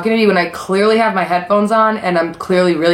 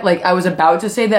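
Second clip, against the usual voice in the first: usual speaking voice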